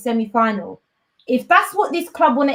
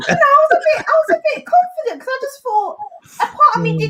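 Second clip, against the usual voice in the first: high whine; 14 semitones higher, faster than usual. Real, not acted. Whiny confession of hope.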